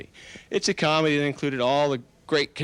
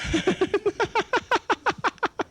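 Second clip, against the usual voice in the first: long falling high laugh; high drawn-out laugh. Shown as a demonstration. Hypnotist demonstrates laughing for the group, long laugh. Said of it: "you already start to laugh".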